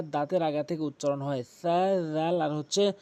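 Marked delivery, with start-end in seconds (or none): none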